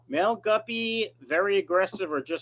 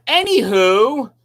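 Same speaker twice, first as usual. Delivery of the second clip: Anywho stretched slow, louder, rising at the end; drawn-out Anywho; 4 semitones higher, 9 dB louder, slower than usual. Shown as a demonstration. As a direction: drag out Anywho brightly to change the subject. Repeats word, stretched and loud.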